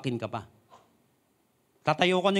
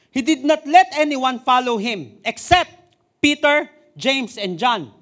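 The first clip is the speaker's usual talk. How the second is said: raised, slow naming of the disciples; loud slow proclamation; 4 semitones higher, 6 dB louder, slower than usual. Acted. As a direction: call out each name. Preacher stresses the names. (malakas na pagbanggit ng mga pangalan)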